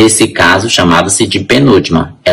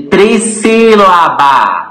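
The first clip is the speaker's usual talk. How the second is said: "trissílaba" stretched out slowly, higher; syllable by syllable; 8 semitones higher, slower than usual. Shown as a demonstration. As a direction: draw out each syllable of "trissílaba". Teacher spells out new term. ("trissílaba" bem esticada, sílaba por sílaba)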